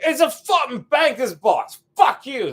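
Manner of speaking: high, loud, aggressive mock British; British accent. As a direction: snap it out in irate British. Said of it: "the energy I would have to assume".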